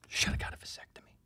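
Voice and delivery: whispered throwaway punchline; whispered aside. Real, not acted. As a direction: toss it away under your breath. Muttered dad-joke aside.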